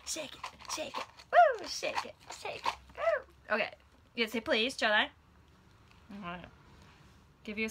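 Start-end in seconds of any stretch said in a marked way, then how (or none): 1.3-1.7 high loud cheer; excited woo. Real, not acted. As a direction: whoop with excitement.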